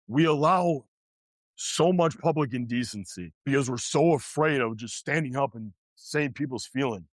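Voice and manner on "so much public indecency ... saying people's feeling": ordinary delivery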